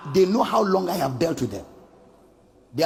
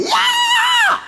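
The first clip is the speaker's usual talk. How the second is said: very high, loud imitated demon shriek; demon scream; 6 dB louder than usual. Demon screams back at the exorcist, piercing shriek. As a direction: screech like a demon being cast out.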